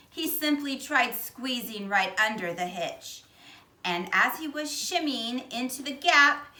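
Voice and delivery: ordinary delivery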